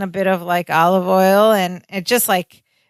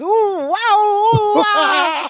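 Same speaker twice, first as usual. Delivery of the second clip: much higher, stretched out ooh wow; drugged-up wonder; 12 semitones higher, much slower than usual. Retold or imitated. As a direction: gasp it like the drug just hit. Balki high after eating root.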